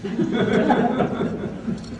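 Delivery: short high amused laugh. Real, not acted. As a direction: laugh at your own joke. Joke about clueless online reader.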